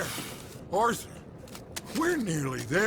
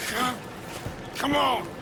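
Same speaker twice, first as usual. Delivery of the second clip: urgent call; 6 semitones higher than usual. Acted. Urging the group to flee.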